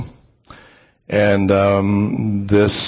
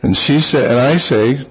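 usual speaking voice

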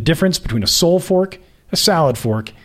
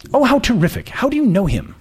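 put-on higher, gushing society girl; gushing socialite; 8 semitones higher than usual. Friend in a cab impressed by the name, higher gushing voice. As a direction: gush like an impressed rich girl.

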